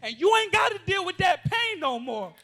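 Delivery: preacher to abused youth in the room, loud and impassioned; full-force preaching. Acted. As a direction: cry it out to the congregation.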